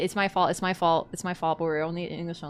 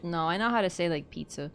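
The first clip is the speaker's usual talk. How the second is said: usual speaking voice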